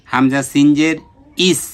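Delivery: normal talking voice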